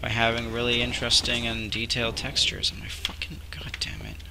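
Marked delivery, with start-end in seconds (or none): 2.7-4.2 whispered cursing under breath; frustrated whisper. Real, not acted. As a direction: hiss the curse quietly in frustration. Frustrated muttering at the game.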